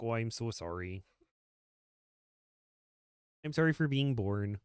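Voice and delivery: mock Midwestern apology; Midwest accent. Joking about mom from up north, Midwest accent.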